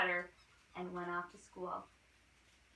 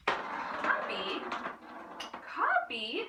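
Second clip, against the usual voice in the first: high, loud disbelieving repeat; can't believe the word he heard; shocked echo; 10 semitones higher, 4 dB louder, much slower than usual. Acted. Tommy repeating the teacher's word in shock.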